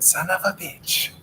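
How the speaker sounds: a light imitation of Jean-Claude Van Damme cursing; Jean-Claude Van Damme impression. Comedian's take on the catchphrase in a light Jean-Claude Van Damme accent. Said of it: "he would do Jean-Claude Van Damme".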